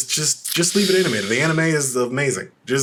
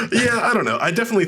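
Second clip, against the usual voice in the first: laughing; 11 semitones higher, faster than usual. Real, not acted. Laughing hard.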